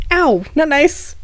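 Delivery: high, drawn-out mock hurt; pained protest. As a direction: complain high and slow, playfully wounded. Character hit while being teased.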